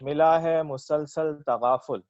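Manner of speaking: slow, measured verse delivery; ghazal recitation. Acted. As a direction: recite it like a poet at a mushaira. Recites own ghazal at mushaira.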